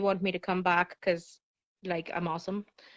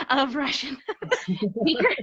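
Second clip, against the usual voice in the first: breaking into laughter mid-phrase; laughing; 5 semitones higher than usual. Real, not acted. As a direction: laugh between the words, pitch climbing. Cracks up admitting a bad accent.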